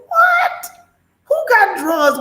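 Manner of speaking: shocked what. Real, not acted. Incredulous reaction to drug claim.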